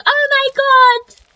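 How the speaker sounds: very high, loud, drawn-out mock exclamation; high-pitched mimicry. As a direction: squeal it slowly, imitating her reaction. Mimics her exaggerated reaction.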